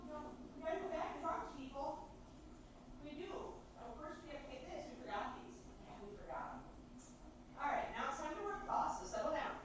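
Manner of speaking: speaking away from the microphone; far off. Real, not acted. Streamer calling back to chat from far off. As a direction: talk from across the room.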